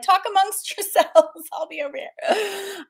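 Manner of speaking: high laughing retreat from the grandkids; laughing mock escape. Overwhelmed grandma leaving the kids, laughing. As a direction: toss it off while laughing. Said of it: "I'd be like".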